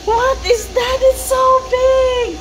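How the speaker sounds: put-on high-pitched wonder for a toddler; playful amazement. Mother shows child a big animal, playful amazement.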